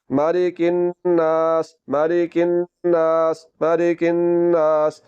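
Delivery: slow, held nasal n into a long "नास"; drawn-out recitation. Shown as a demonstration. Teacher modelling the whole word for students, slow drawn-out recitation. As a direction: recite "मालिकिन नास" slowly, stretching n and aa. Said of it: "लफ्ज़ पूरा क्या बन जाएगा".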